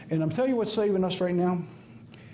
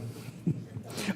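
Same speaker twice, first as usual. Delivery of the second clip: soft laugh; 9 dB quieter than usual. Real, not acted. Laughing at methane plan.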